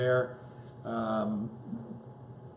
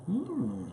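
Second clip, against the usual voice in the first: savoring hum; 12 semitones higher, 3 dB quieter than usual. Real, not acted. Tasting the eggplant dish.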